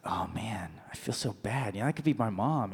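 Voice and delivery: hushed, sorry aside to yourself; heartfelt pity. Retold or imitated. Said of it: "my heart went out to her".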